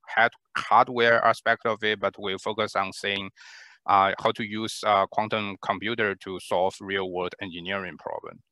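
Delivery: normal talking voice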